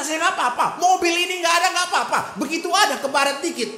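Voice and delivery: loud, high fervent confession; preaching at full force. Acted. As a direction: cry it out to God.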